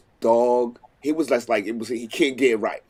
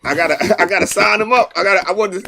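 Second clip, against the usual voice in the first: higher, louder and fast, in a laughing panic; mock panic; 6 semitones higher, 7 dB louder, faster than usual. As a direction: blurt it out like you're about to lose him. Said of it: "And I was like".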